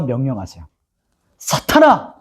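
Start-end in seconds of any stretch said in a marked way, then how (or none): 1.4-2.2 ringing high command to Satan; proclaiming command. Retold or imitated. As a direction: call out the name with authority. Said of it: "이렇게 선포하시는 거예요". (사탄에게 높이 외치는 명령)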